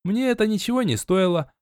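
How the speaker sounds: ordinary delivery, nothing marked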